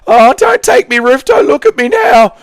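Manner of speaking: very high, loud mock wail; hammed-up plea in a strained high voice; Leon. Roof owner begging the thief not to take it, wailing. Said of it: "harvesting Leon's roof for materials".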